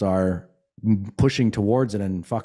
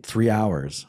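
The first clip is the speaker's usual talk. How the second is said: ordinary talk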